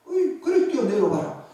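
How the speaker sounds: high, cheery call to jump; hammed-up playful invitation to jump down; Jesus' bold reply. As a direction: toss out a playful dare. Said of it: "기가 막힌 말들을 정말 합니다".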